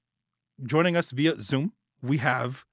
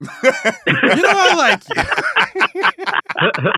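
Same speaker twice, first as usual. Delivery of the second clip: laughing mock confession; 14 semitones higher, 8 dB louder, much slower than usual.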